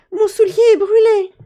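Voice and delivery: very high, loud complaining fairy; whining fairy. Acted. Little fairy complaining to her mother, high and loud. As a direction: whine to mother.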